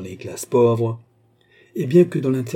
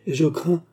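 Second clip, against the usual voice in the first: put-on higher-pitched, forceful old gentleman; avec force: forceful; 7 semitones higher than usual. A narrator's reading. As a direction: press the concern firmly. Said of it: "ajouta-t-il avec force". (avec force, voix plus aiguë)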